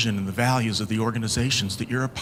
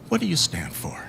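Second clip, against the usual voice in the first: normal talking voice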